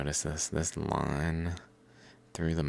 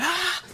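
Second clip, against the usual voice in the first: very high startled shriek; high animal shriek; 5 dB louder than usual. Retold or imitated. Frightened animal shrieking, very high. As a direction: shriek like a scared wild animal. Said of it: "they're like".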